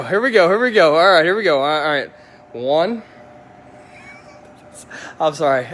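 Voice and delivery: ordinary talk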